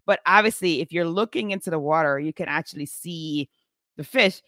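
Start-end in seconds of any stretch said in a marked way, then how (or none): none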